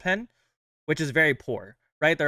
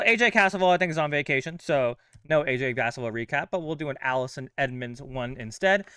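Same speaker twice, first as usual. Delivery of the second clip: ordinary talk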